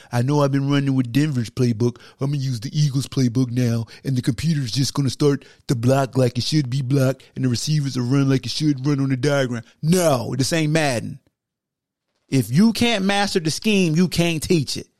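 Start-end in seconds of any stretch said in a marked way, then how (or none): 0.1-9.6 mock player voice. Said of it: "This ain't Madden where you could just".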